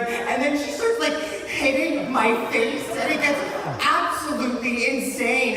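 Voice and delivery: high, loud, breakneck excitement; worked-up storyteller at the climax; frenzied retelling. Retold or imitated. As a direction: build the story to a fever pitch.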